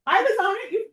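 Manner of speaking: high, loud laughing aside about her own show. Real, not acted. Laughing self-deprecating aside.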